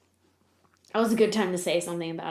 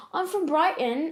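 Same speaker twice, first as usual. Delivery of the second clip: put-on higher-pitched British accent; 8 semitones higher, slower than usual. Telling a band where she's from, put-on British accent. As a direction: play a fake Brit, pitched up. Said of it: "I put on a British accent".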